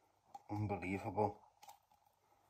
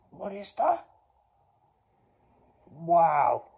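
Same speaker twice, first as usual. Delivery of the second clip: usual speaking voice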